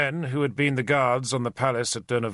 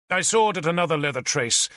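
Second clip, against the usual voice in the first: usual speaking voice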